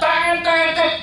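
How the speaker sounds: high, loud "tet tet" horn noise with a laugh; mock horn honks. Preacher imitating Jakarta car horns, mock honking.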